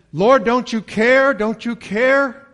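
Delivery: high, loud, slow anguished cry; desperate plea. Retold or imitated. Believer in the storm crying out to the Lord, slow and anguished. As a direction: plead as if sinking.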